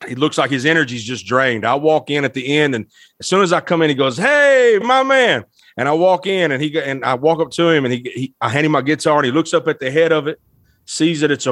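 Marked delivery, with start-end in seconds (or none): 4.2-5.4 high, drawn-out acted hail; retold delighted greeting. Guest voices B B King welcoming him in, delighted hail. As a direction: call it out higher, as to a favorite pal.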